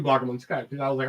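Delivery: ordinary talk, nothing marked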